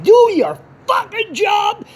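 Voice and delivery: furious shout, high and drawn out. Retold or imitated. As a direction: yell it slowly, hitting every word. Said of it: "And I, I was furious".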